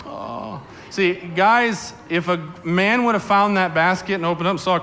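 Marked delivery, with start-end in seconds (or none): 0.1-0.6 put-on soft breathy aww at a baby; tender coo. Ladies seeing a crying baby, melting soft coo. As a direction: melt into a hushed oh. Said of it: "all you ladies are like".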